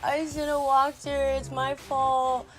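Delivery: hammed-up tearful self-blame; imitated bawling. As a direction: whine it out like a sobbing little brother. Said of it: "he was bawling in tears".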